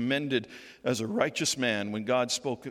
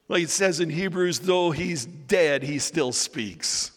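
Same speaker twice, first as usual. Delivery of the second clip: louder, slow preaching build; full-force preaching; 5 semitones higher, 5 dB louder than usual. Acted. Preacher rising to the climax before the congregation, loud. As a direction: drive it home from the pulpit.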